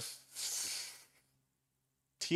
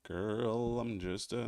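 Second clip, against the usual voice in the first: ordinary talk, nothing marked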